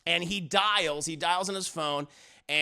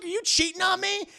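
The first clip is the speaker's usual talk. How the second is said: high, quick mock accusation; acted hurt accusation; 10 semitones higher, faster than usual. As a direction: ask as the betrayed husband, high and fast. Husband accuses wife.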